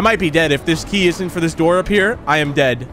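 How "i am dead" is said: normal talking voice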